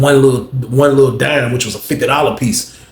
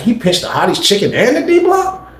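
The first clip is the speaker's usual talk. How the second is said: pitched way up in disbelief; surprised question; 10 semitones higher than usual. Retold or imitated. Retelling to the listeners, a high disbelieving question. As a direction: ask it like you can't believe it.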